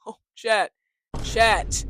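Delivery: loud exasperated "chat". Real, not acted.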